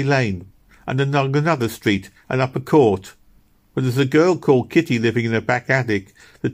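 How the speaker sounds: a bustling woman explaining what she knows; motherly neighbour voice. A narrator's reading. Missus Blossom tells the lady where Meg lives, chatty and vexed.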